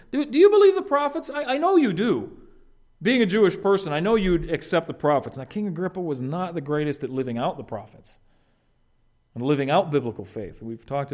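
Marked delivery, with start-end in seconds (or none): 0.1-5.4 eager appeal. Retold or imitated. Paul paraphrased to the king.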